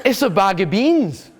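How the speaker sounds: lightly voiced puzzled teenager with a laugh; mocking a bemused youngster; kid's puzzled question. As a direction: play the curious kid, amused. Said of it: "he goes".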